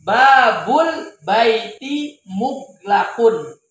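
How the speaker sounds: Babul baiti muglakun read very slowly, every syllable drawn out; slow, clear model sentence. Shown as a demonstration. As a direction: read it out slowly for the class to repeat. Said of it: "Misalnya apa?".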